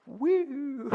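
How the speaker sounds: high-pitched whooped "woo". Retold or imitated. Dad reacting to odd answer, high whoop. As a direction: let out a very high, put-on woo.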